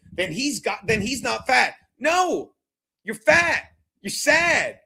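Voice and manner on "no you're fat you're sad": raised-pitch scornful jabs; scornful rant. Real, not acted. Streamer ranting at his online haters, scornful. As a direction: fire insults in a higher voice.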